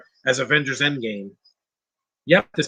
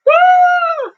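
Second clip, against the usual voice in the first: mock whoop; 9 dB louder than usual. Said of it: "It's like".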